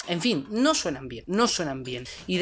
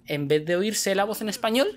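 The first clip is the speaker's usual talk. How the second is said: ordinary talk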